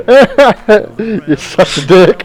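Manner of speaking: high-pitched laughing jab, slowed by giggles. Real, not acted. Player to friend joking about mugging an old guy, laughing. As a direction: tease a friend through real laughter.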